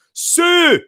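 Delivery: loud, high, drawn-out Scottish cry; Scottish accent. Shown as a demonstration. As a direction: belt out Sue high with a Scottish vowel. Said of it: "I say Sue in a Scottish accent".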